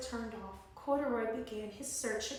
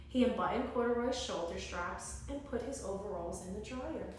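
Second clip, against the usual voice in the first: ordinary delivery, nothing marked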